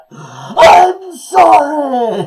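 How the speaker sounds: drawn-out, high shouted apology; battle cry. Acted. Host acting out a Canadian hero's battle cry, shouted. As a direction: yell it long and loud like a war cry.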